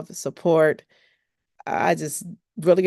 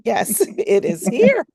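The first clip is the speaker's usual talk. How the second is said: laughing; 5 semitones higher, 5 dB louder than usual. Real, not acted. Laughing after the group cheer.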